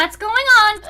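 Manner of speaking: loud, high startled cry. Acted. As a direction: call out in confused alarm. Startled, eyes suddenly covered.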